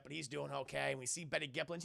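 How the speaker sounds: ordinary delivery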